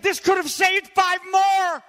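a hammed-up grieving outcry; anguished Schindler. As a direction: wail it as remorse breaks through. Schindler's remorse at film end.

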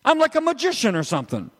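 high, quick comic brag; mock boasting. Preacher voices the brother bragging about the calf, mock boasting. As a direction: toss off a silly boast, high and fast. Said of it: "And they said".

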